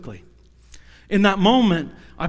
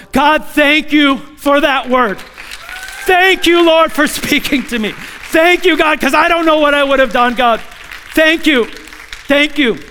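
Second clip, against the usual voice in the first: fervent thanks; 8 semitones higher, 6 dB louder than usual. Retold or imitated. Thanking God, emotional.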